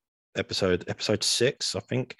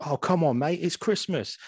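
Harry Enfield quote; 8 semitones higher than usual. Retold or imitated. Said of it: "he was like".

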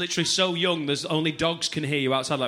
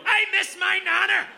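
hammed-up sobbing shout at a funeral; mock drunk mourner; 13 semitones higher than usual.

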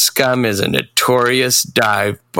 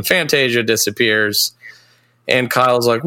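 usual speaking voice

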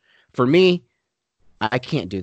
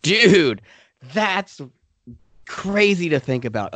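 amazed; 6 semitones higher, slower than usual. Retold or imitated. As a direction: blurt it out in disbelief. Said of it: "I'm like".

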